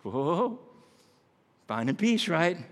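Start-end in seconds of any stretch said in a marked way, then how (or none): none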